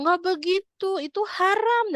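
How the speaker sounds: high-pitched scolding correction; know-it-all wife. Acted. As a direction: correct your husband like you know better. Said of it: "lebih pintar gitu karena udah paham agama".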